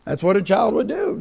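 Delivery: ordinary talk, nothing marked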